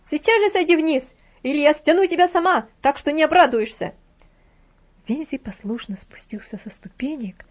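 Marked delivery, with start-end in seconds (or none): 0.0-3.9 higher, much louder threatening shout; angry threat, shouted; furious threat. A narrator's reading. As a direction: yell a threat down at him. Frau Troll keeps yelling at Vinzi.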